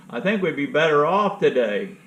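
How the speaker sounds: normal talking voice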